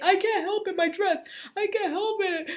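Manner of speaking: put-on high, loud, laughing kid complaint; whiny child. Child squeezed into a tile whining to teacher, high and loud. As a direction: whine like a squashed kid.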